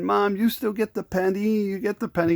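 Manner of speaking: high, pleading child's voice imitated; begging little kid. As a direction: beg Mom like an eight-year-old. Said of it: "and I begged".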